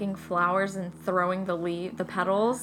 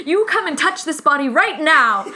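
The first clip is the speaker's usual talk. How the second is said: loud, high, laughing command; excited insistent shout; 5 semitones higher, 7 dB louder than usual. Retold or imitated. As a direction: bark it out eagerly, half laughing. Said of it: "He's like".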